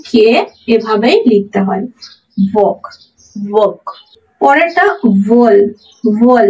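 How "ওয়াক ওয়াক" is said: ওয়াক with an o vowel and silent l. Shown as a demonstration. Teacher repeats walk for learners, ওয়াক with o-like vowel. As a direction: model ওয়াক word by word, no l heard. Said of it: "ওর মতো উচ্চারিত হবে".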